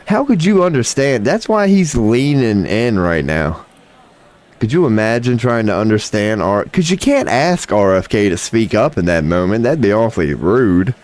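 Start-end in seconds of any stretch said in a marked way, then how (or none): none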